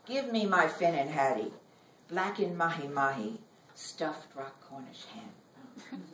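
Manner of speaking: ordinary talk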